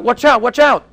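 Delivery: shouted warning, high and loud; calling out a warning. Retold or imitated. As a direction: yell it to stop him at the edge. Friend calls out near cliff.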